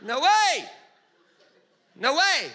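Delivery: high, loud incredulous "no way"; mock disbelief. Preacher acting out shocked listeners, incredulous. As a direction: gasp it out in hammed-up disbelief.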